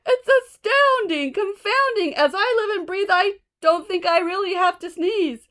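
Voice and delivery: high, loud amazed exclaim; delighted. Acted. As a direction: burst with happy astonishment. Elephant astonished and delighted.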